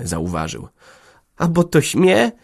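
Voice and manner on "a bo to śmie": high, bashful little squeak; a shy girl's high squeak. A narrator's reading. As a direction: squeak it out shyly. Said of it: "pisnęła wstydliwie".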